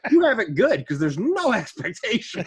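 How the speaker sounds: laughing. Real, not acted. Retelling friend's joke, laughing.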